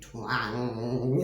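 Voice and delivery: low, slow, drawn-out twang; imitated toy twang. Telling listeners about the toy in the loft, a low slow twang. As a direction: sound out the toy's spring. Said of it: "like, like that".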